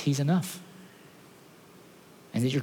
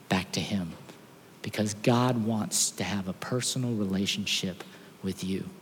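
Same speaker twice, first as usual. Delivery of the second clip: slow, much softer heartfelt telling; softer, slow, tender preaching; tender sermon close; 4 semitones lower, 6 dB quieter than usual. Acted. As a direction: draw them in gently, slowly. Sermon climax, slow and soft.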